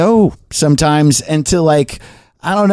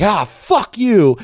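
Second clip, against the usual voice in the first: high, drawn-out mock outrage; mock screamed insult; 7 semitones higher, much slower than usual. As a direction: stretch the curse out, pitched up without real volume. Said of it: "someone screaming in my face".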